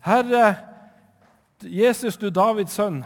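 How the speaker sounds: loud, high pleading call; desperate loud cry for mercy. Acted. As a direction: call out to Jesus from the roadside. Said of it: "han satt og ropte".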